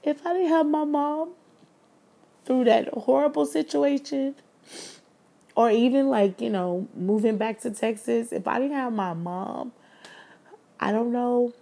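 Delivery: high, choked-up voice on the edge of crying; emotional, voice breaking high; holding back tears. Real, not acted. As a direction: push through the words as tears rise. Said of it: "I'm trying not to cry".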